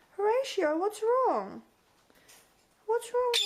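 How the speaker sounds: high concerned pet voice; high coaxing. Real, not acted. Owner asking her screaming bird what's wrong, high coo. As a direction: ask the bird gently up high.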